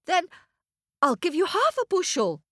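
lightly voiced small boy; boy bargaining. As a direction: offer it like a hopeful child.

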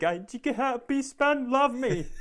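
mock desperate uncle pleading with nephew; needy uncle plea. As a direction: beg half-laughing for affection. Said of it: "thirsty uncle type stuff".

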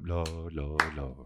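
hushed congregation parody; background murmur. Retold or imitated. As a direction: murmur like the back pews. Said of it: "everyone in the background goes".

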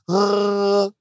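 imitating a baby's gargle grunt; a toddler's little copycat grunt; baby gargle sound. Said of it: "She's like".

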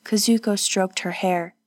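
ordinary delivery

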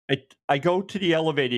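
usual speaking voice

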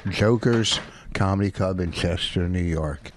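ordinary delivery

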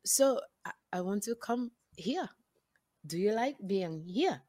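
halting, careful Botswanan accent. Shown as a demonstration. Actress shows her audition talk with the director, Botswanan accent. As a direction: try out a freshly learned Botswanan accent, softly. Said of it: "just learned this Botswanian accent".

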